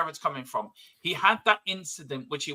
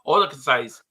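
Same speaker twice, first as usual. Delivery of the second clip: usual speaking voice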